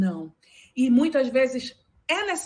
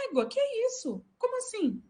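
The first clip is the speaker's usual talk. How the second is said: incredulous reaction, pitched higher and softer; indignant disbelief; 9 semitones higher, 7 dB quieter, faster than usual. Retold or imitated. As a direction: react as if you can't believe what you just heard. Outraged reaction to the quote.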